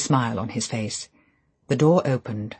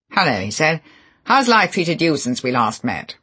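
louder, higher, sneering; Favell's mocking greeting; 4 semitones higher, 7 dB louder than usual. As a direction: needle the old man with false cheer.

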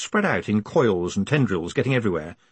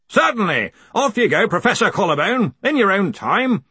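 raised, high-pitched hearty voice; hearty Arch-Chancellor; 10 semitones higher, 5 dB louder than usual. A narrator's reading. Arch-Chancellor tells the professor to carry on, hearty call. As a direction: call out an encouraging go-ahead.

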